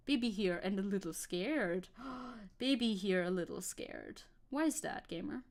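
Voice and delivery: ordinary delivery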